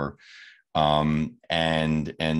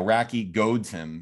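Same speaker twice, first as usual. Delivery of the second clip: ordinary talk, nothing marked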